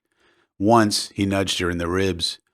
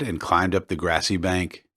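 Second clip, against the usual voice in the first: ordinary delivery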